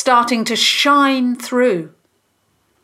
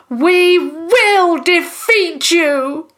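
high, loud, slow battle cry; drawn-out yell of defiance; defiant shout; 10 semitones higher, 5 dB louder, much slower than usual. A narrator's reading. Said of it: "Tom shouted from his cage".

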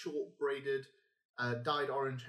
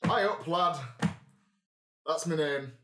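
put-on northern accent; 2 semitones higher, 6 dB louder than usual. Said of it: "Is that northern accent?".

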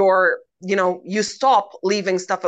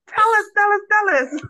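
high, eager begging; excited pleading; 12 semitones higher than usual. Real, not acted. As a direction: beg them to spill it.

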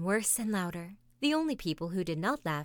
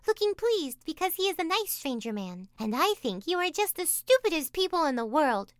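put-on Taffy, indignant child; 9 semitones higher than usual. A narrator's reading.

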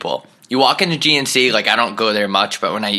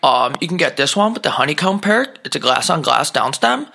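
higher, chattering salesman pitch; eager shop clerk; 5 semitones higher than usual. Retold or imitated. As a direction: rattle off the gear like a keen clerk. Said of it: "He's like".

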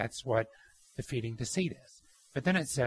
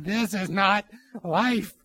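slow disbelief; 6 semitones higher, 6 dB louder, much slower than usual. Retold or imitated. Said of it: "I was like".

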